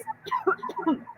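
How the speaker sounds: sudden choking cough; real cough, something in the throat; coughing on a bug. Real, not acted. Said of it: "I think I just swallowed a bug".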